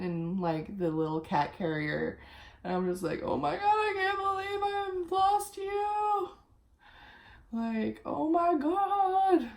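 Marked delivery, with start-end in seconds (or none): 3.2-9.5 put-on much higher, sing-song and drawn-out, like cooing to a baby; sing-song baby talk. Reliving crying over her found cat in the carrier, high sing-song cooing. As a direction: coo over the cat in a high, slow, singing voice.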